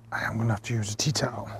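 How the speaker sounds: whispered aside to himself; quick whisper under his breath. Real, not acted. Handling hot lamb, muttering aside.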